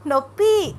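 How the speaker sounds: high, loud cry for help; wife's narrated pain cry, pitched well up. A narrator's reading. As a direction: call out loudly for your husband, hurting. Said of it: "అంటూ గట్టిగా అరిచింది అనన్య".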